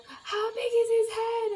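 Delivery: high, loud panicked squeal; a shrill mock-panic question; shocked shriek. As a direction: squeal it out in disbelief. Shocked mid-labour question.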